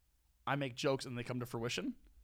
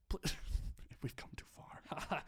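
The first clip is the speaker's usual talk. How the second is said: whispering; 6 semitones higher, slower than usual. Real, not acted. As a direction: giggly whisper. Whispered giggling in dark.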